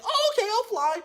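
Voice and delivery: high, easily won-over voice; lightly voiced Dumbo. Dumbo won over by a feather, high voice. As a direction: brighten up instantly. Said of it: "And he's like".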